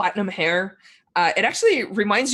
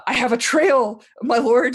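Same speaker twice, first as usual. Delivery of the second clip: higher-pitched, mildly pleading report; anxious underling; 5 semitones higher, 4 dB louder than usual. Acted. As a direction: plead upward to your master. Underling pleads with his feared lord.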